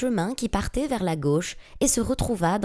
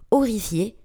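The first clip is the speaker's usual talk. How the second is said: ordinary delivery, nothing marked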